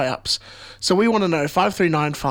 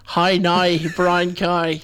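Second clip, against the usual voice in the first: Northern Irish vowels, hi now brown cow; Northern Irish accent; much slower than usual. Shown as a demonstration. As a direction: say it like Belfast. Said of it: "if you say in Northern Irish".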